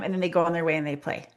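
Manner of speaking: usual speaking voice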